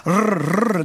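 rolled rrr of a failing starter; imitated engine sputter. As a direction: mimic the motor not catching. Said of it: "berichtet das so schön".

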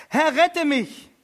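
hoher, lauter, langsam gedehnter Schrei; verzweifelter Hilfeschrei. Acted. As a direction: schreien wie ein Ertrinkender. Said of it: "schrie er und sprach". (Petrus schreit beim Versinken verzweifelt um Hilfe)

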